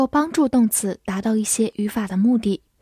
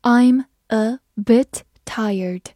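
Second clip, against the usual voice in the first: word-by-word slow teacher reading; slow, separated words for learners to follow; slow read-aloud; much slower than usual. Shown as a demonstration. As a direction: read it slowly, pausing after each word. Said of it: "好，慢慢来读".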